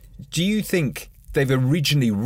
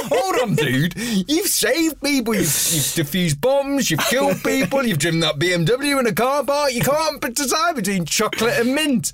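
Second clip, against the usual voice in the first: incredulous mock rant; 12 semitones higher than usual. Ice cream server to James Bond who can't decide, incredulous rant. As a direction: pile up his feats in mounting mock disbelief.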